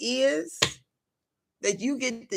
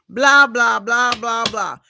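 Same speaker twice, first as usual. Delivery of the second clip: mocking chatter; 8 dB louder, slower than usual.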